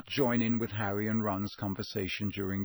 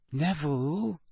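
gentle; 4 semitones higher than usual. A narrator's reading. Girl approaches friend standing alone, gentle. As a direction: check on him carefully. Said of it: "Hermione said gently".

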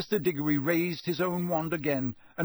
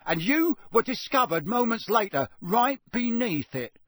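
raised, accusing Ministry official; stern official; 6 semitones higher, 3 dB louder than usual. A narrator's reading. Diggory questioning the elf, stern and accusing. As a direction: demand an explanation from the elf. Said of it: "said Mister Diggory".

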